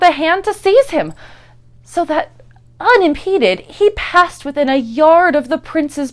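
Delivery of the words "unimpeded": ordinary delivery, nothing marked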